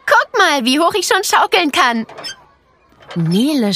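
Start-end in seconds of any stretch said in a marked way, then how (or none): 0.1-2.1 put-on excited girl voice. A narrator's reading. Clara shows off on swing.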